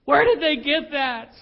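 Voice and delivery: mock-bewildered bedtime prayer; a parent asking God where kids learned it; exasperated prayer. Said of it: "praying by my bed".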